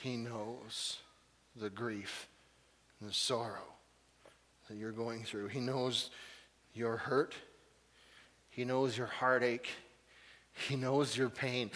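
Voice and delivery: quiet pastoral comfort; tender reassurance. Acted. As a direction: gently assure them he knows. Tender comforting passage to congregation.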